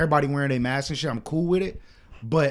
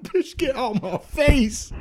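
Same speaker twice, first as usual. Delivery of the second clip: slow, higher, mock dismissive brush-off; 8 semitones higher, slower than usual. Woman praises his sobriety, slow mock brush-off. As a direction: drag it out like shooing her off.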